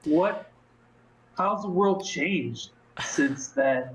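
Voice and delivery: slow, dragging words; slow molasses speech. Acted. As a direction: let every word stick like molasses. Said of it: "my words come out like they're covered in molasses".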